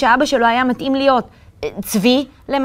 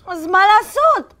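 shout; 8 semitones higher, slower than usual. A narrator's reading. Itamar shouting at his mother in despair. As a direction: cry out for help. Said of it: "צעק איתמר".

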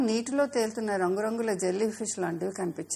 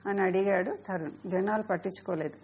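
ordinary delivery, nothing marked

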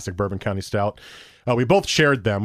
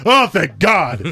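louder, slow, drawn-out cry of relief; finally home with good beer, hammed-up; 6 semitones higher, 7 dB louder, much slower than usual. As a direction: stretch it out like heaven opened. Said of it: "repeated louder and slower".

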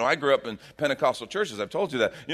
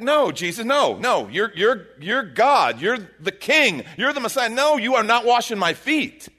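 Peter protesting; 8 semitones higher, 6 dB louder than usual. Retold or imitated. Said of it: "It's like".

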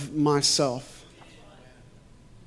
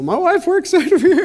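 laughing retelling; 14 semitones higher, 6 dB louder than usual. Retold or imitated. Telling stranger wife works there.

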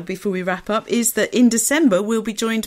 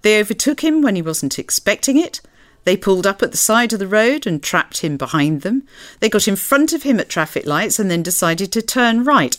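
measured reading of a novel, voicing each character; story narration; 2 semitones lower, 4 dB louder than usual. A narrator's reading. Presenter reading a novel extract to listeners, storytelling voice. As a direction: read the car scene aloud like a book.

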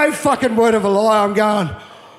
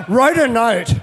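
ordinary talk

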